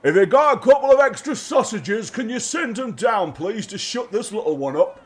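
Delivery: high, loud guard's call; yelling upstairs. Acted. Guard yelling upstairs for sausages, loud call. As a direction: holler it up a flight of stairs. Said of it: "yells up the stairs".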